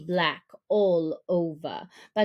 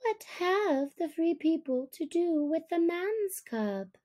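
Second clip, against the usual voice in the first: put-on higher, challenging young voice; young wolf; 7 semitones higher than usual. Young wolf challenging Akela, higher and defiant. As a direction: toss the question back, pitched up.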